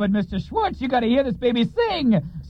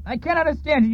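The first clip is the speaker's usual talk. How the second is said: ordinary talk, nothing marked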